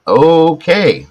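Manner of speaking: loud high startled okay; mock surprise. Hearing his wife's compliment, loud mock surprise. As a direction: jump up in loud mock shock.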